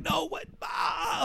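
high, mock panicked cry; hammed-up panicky wail; imitated freak-out. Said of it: "like, he's freaking out".

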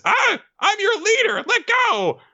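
very high, squealed protest; Nobuteru. Acted. Leader pinched by his crabs, high squealing command. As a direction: squeal orders as a little cartoon creature. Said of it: "And Nobuteru says".

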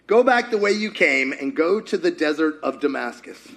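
ordinary talk, nothing marked

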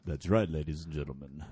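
low breathy rock-star purr; [name] impression. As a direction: purr it like a seventies rock singer. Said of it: "This is my best [name] imitation".

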